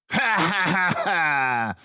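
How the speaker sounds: high, loud mocking villain laugh; harsh laugh. Pickpocket laughing harshly at captured Basil. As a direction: cackle at them. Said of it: "laughed harshly".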